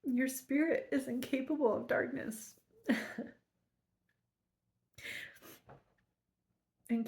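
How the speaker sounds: wiping tears and pushing on through the line; teary. Real, not acted. Rereading to viewers after crying, tearful with a laugh. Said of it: "get these tears out of my eyes".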